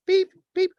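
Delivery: loud, high-pitched imitated beeps; squeaky electronic beep, done by mouth; robot beeps. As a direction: beep like a glitching machine. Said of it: "It's a malfunctioning robot".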